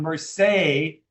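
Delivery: "Mercedes" cut to "mer-say", with a long A; second E as A. Shown as a demonstration. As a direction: sound out "Mercedes" only as far as "mer-SAY". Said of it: "The second one is an A sound".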